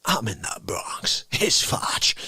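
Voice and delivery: low hushed whisper. Acted. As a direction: whisper the news.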